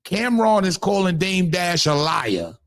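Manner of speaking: normal talking voice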